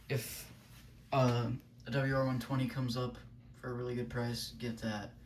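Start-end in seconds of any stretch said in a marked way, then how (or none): none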